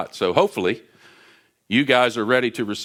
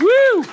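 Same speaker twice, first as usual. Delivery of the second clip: very high, loud whoop after a laugh; excited whoop; 9 dB louder than usual. Real, not acted. Greeting Kevin Kelly as he arrives, loud high joyful whoop. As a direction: cheer the friend onto the stage.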